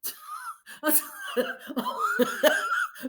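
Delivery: squealing high-pitched laugh. Real, not acted. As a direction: burst into a high cackle. Laughs at her own story.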